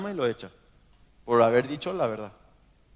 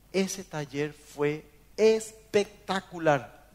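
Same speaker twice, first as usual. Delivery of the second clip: "espectacular" lento y recalcado; adolescente impresionado; much slower than usual. Retold or imitated. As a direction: cita a un adolescente maravillado, alargando las palabras. Adolescente elogia un taller. (impresionado, con énfasis pausado)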